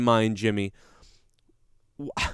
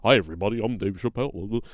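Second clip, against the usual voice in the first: Dave Chappelle; 5 semitones lower, 4 dB quieter, faster than usual. Retold or imitated.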